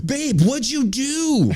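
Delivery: high, drawn-out wail; mock upset wife. Wife finding her car damaged, high mock dismay.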